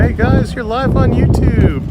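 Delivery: high, loud, slow hello; excited greeting. Real, not acted. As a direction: call out to the viewers. Greeting a live stream.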